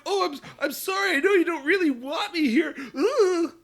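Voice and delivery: high, yelping, panicked mock apology; flustered apologizing boy. Mocking Bell as he trips over women, high and panicky. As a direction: play the shy hero stumbling into women, squeaking sorry. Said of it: "And he's like".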